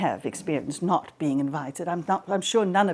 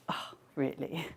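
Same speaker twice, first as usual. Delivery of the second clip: dry disdain; 5 semitones lower, 9 dB quieter than usual. Acted. Hera unimpressed by the squabble.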